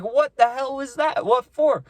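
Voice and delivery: replaying the shock of being slapped; stunned disbelief. Slapped by the icy girl, he asks her in stunned disbelief. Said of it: "I was literally stunned".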